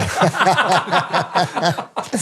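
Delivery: rising burst of laughter; laughing. Real, not acted. Laughs after telling his wipeout.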